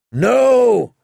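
loud, high shouted answer. A narrator's reading. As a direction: bellow it back. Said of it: "Grenn shouted".

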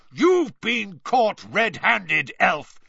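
loud, slow, drawn-out accusation; roaring Mister Diggory. A narrator's reading. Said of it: "Mister Diggory roared".

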